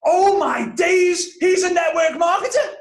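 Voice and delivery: high, loud, astonished yell; top-of-voice shout. Retold or imitated. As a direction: shout it out across a packed cinema. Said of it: "at the top of his voice, like, shouts".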